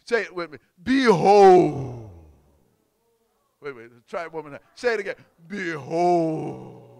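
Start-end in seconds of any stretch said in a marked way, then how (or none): 0.8-2.1 loud, grand, long-held proclamation; majestic. Shown as a demonstration. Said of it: "You gotta say it like majestically". 5.5-6.9 drawn-out, loud, grand proclamation; majestic. Shown as a demonstration. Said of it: "Let's see if we can do it majestically".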